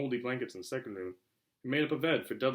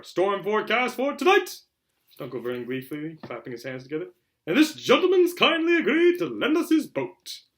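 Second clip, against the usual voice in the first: over-the-top glee; 9 semitones higher, 11 dB louder than usual. Shown as a demonstration. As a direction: ham up his glee.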